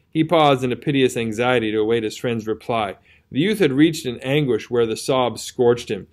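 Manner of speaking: usual speaking voice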